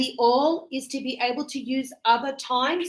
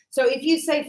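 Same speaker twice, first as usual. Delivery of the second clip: ordinary talk, nothing marked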